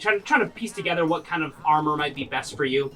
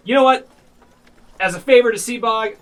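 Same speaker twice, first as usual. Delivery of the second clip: generous announcement; 7 semitones higher, 7 dB louder than usual. Acted. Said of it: "he says".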